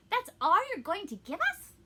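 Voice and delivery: high, loud disbelieving protest; indignant. A narrator's reading. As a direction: complain in an acted, offended question. Cat protests the meagre gift.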